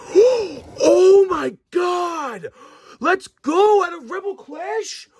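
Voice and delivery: high, drawn-out cries of amazement; real excited shout. Real, not acted. Card opener to viewers on a surprise pull, thrilled shouting. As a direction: shout it out in stunned delight. Pulls a rare card unexpectedly.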